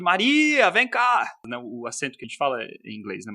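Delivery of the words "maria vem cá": loud, high call with a stretched stressed syllable. Shown as a demonstration. Linguist demonstrating calling his sister, calling out. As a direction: shout for Maria to come over. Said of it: "você chama ela de".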